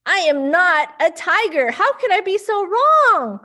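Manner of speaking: dismayed realization. Acted. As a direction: sound shocked at yourself. Mouse realizes mistake.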